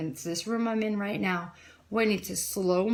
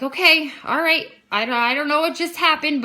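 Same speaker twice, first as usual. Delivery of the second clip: high, loud, flustered acceptance; retold bewilderment; 7 semitones higher, 6 dB louder than usual. She answers her insistent friend, retold flustered bewilderment. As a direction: act out giving in while baffled. Retells dazed reply to friend.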